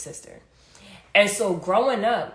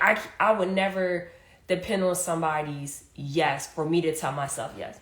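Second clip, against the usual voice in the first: ordinary talk, nothing marked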